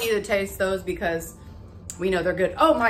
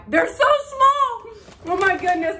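surprised; 10 semitones higher, 6 dB louder than usual. Real, not acted. Surprise at tiny mochi.

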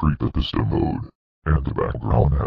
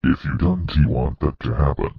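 ordinary talk, nothing marked

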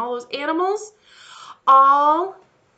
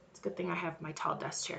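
usual speaking voice

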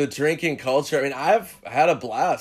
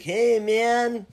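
high, laid-back dreadlocked juice bar guy; stoner dude; 9 semitones higher than usual. Retold or imitated. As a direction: do the stoner in a raised, lazy voice.

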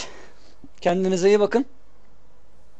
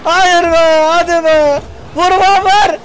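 excited; 7 semitones higher, 3 dB louder, slower than usual. Real, not acted. Tense near goal.

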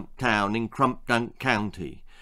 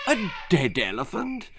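horrified; 5 semitones higher than usual. Acted. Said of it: "said their horrified mother".

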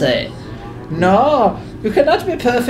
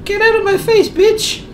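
high, rude, sneering character voice; high snotty voice; 10 semitones higher than usual. Acted.

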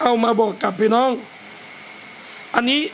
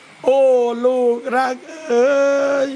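put-on tender call to a child; slower than usual. Shown as a demonstration. Preacher shows how to call a child, tender. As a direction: coax the child gently, lovingly. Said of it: "เวลาเรียกลูกเนี่ยนะ เรียกท่านนี้เลยนะ".

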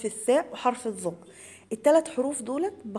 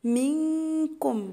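slow recitation with nasal hold; 5 semitones higher, much slower than usual. Shown as a demonstration. Teacher shows students the nasal ghunna in منكم. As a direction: hold the hidden nun long and high. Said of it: "فيها غنة إخفاء".

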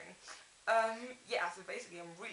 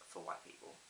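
whispered; 14 semitones lower, 9 dB quieter than usual. Real, not acted. Whispered aside about brands.

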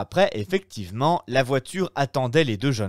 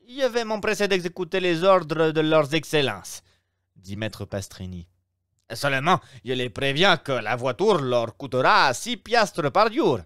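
higher, louder obsequious innkeeper voice; innkeeper, fussy; 6 semitones higher, 5 dB louder than usual. Acted. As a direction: play the eager Roman host, pitched up. (aubergiste empressé, voix plus aiguë et plus forte)

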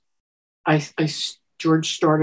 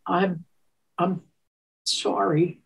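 quiet, halting apology; soft timid apology; 6 dB quieter, slower than usual. Retold or imitated. Woman retelling her apology to George, soft and timid. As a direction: shrink into it. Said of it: "So I said".

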